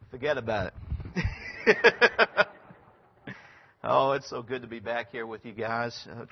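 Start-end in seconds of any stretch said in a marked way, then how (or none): 0.1-2.5 mock New York toughness, "fuhgeddaboudit" style; Yankee accent. Missionary joking to the congregation about Brooklyn, in a hammed-up New York accent. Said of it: "Give us a little of that Yankee accent".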